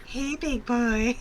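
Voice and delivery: slow, sultry flirty voice; breathy bimbo voice. Pretend. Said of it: "she's got a breathy voice".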